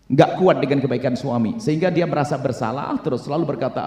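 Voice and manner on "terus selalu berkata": ordinary talk, nothing marked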